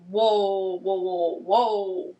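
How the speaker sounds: drawn-out, recoiling whoa, whoa; backing off from him in disbelief; taken-aback whoa. Retold or imitated. Telling her audience how she recoiled, with stunned whoas.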